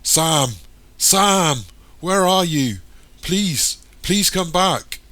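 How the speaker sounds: high, full-voiced calling out; desperate shouting. A narrator's reading. Michael calls for missing Sam at the top of his voice. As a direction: shout for someone lost, pleading. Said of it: "Michael shouts at the top of his voice".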